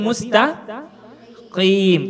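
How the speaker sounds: "mustaqim" slowly, q made far back in the throat; pause, then a heavy "qim" in mustaqim; deep qaf. Shown as a demonstration. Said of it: "Posisi lidah naik". (mustaqim dengan qaf tebal)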